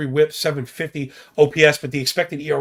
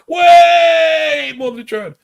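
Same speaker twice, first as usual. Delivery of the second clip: a drawn-out, high, much louder yell; stretched shout; 12 semitones higher, 8 dB louder, much slower than usual. Pretend. Podcast host to listeners, mock-dramatic slow shout. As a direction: belt it out slowly like a chant. Hammering home a joke plea.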